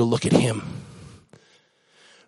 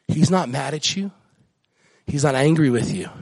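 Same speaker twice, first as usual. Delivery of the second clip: usual speaking voice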